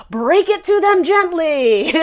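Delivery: high, drawn-out bad comic impression; Ace Ventura. Tarot reader jokes about letting an admirer down, bad movie-character impression. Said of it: "that was my bad impression of Ace Ventura".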